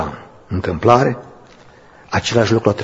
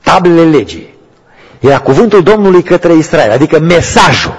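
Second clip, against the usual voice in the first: preaching at full force; 6 semitones higher, 6 dB louder than usual. Real, not acted. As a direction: proclaim it like a testimony. (mărturisire cu putere)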